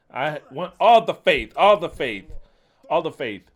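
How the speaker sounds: loud, forceful cry of "all the faith"; preacher booming at his listeners, demanding all their faith; shouted preaching. Real, not acted. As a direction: thunder it out at the congregation.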